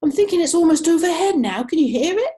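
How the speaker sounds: high, very loud shout. Acted. Mr Burbank shouts to his wife over imagined thunder, loud and high. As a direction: bellow it over a thunder only he hears. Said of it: "Mister Burbank shouted".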